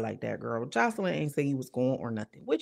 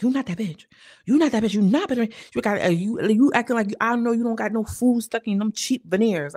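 mock diva tirade; 5 semitones higher, 5 dB louder than usual. [name] breaking girls down.

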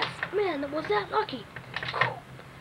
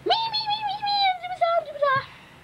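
very high squeaky toy voice; squeaky character voice; 13 semitones higher, much faster than usual. Pretend. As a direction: pipe up high as the toy wrestler. Toy character voicing itself.

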